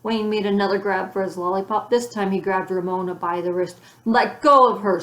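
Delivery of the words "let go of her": much louder angry command. A narrator's reading. Beezus defending Ramona from Wayne, angry command. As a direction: snap it at the boy to protect your sister. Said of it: "said Beezus angrily".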